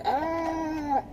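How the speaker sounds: high but restrained mock scream; imitated scream.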